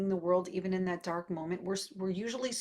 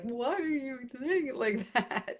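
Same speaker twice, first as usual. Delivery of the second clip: mock wounded child; 6 semitones higher than usual. Parent mimicking the other child's big reaction, mock wounded. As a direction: imitate an upset kid, pitched up with a laugh.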